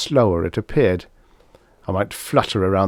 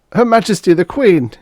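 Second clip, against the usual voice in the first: high, loud, pinched court flunky voice; forcing the words out through thin lips; squeezed-out flunky; 11 semitones higher, 7 dB louder than usual. A narrator's reading. Flunky answering the fake Graf, high, loud and pinched. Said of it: "he managed to squeeze out between thin lips".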